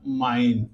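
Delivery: फाइन said low and slow, with a long "aai" sound; teacher demo. Shown as a demonstration. Teacher says फाइन slowly so children hear it. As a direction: model the word फाइन clearly for the class. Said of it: "ये क्या हो जाएगा?".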